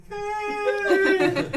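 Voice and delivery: very high, laughing outcry; goo-covered gnome protesting; high indignant hey. Acted. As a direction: yelp at the goo in her hair. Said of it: "She's like".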